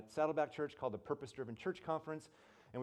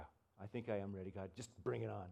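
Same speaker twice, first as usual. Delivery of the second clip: low, muttered snark at God; quietly cocky, daring God to go on; bad attitude; 5 semitones lower, 8 dB quieter than usual. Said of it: "Such a bad attitude".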